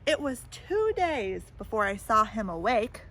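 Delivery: storybook read-aloud voice; lively picture-book reading; story narration. A narrator's reading. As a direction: tell it to a child at bedtime.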